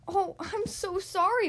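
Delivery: lightly acted, slow regret; apologetic Rabbit. Rabbit apologizing to Bird after the crash, regretful.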